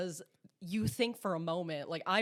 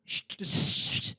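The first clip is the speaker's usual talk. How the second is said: repeated insistent shushes; imitated shushing; slower than usual. Host voices captors silencing the governor, insistent shushing. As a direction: shush over and over, cutting off a talker.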